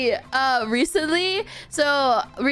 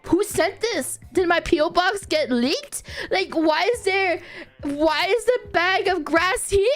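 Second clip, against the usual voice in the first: retold panic; 7 semitones higher than usual. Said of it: "I was freaking out".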